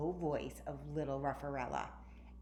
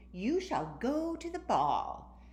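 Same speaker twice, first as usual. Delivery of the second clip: higher, slow, grand proclamation; fairy godmother grandeur; 7 semitones higher, 5 dB louder, slower than usual. A narrator's reading. Girl grandly tells her dog it will go to the ball. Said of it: "she said".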